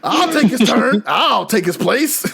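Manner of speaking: high-pitched eager monster voice; volunteer loudly as the comic intruder; Basil Geese. Retold or imitated. Monster offering to replace the one who fled, hammed-up. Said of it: "Basil Geese is like".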